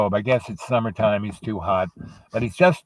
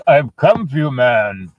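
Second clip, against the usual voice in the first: raised, louder calls with pauses; Death summoning; 5 semitones higher, 4 dB louder than usual. Acted. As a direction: summon the old lady as Death, word by word. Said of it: "and he goes".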